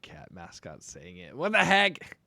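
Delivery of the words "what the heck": startled what the heck. Real, not acted. Reacting to cat mascot idea.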